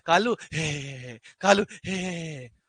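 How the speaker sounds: imitated baby gleefully reacting to a nickname; mock happy cooing with drawn-out squeals. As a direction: play a delighted, clueless little one. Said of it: "वो खुश हो जाता है".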